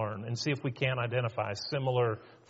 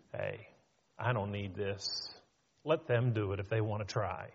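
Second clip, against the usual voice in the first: low, soft resigned dismissal; dismissive quitting; 7 semitones lower, 6 dB quieter than usual. Acted. Church member criticized for doing good, resigned and dismissive. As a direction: give up under your breath, low. Acting a discouraged person quitting.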